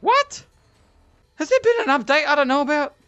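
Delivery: high-pitched, incredulous questioning; astonished. Real, not acted. Player discovering an unknown update, high astonished questioning. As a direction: squawk it in disbelief. Discovers unexpected new feature.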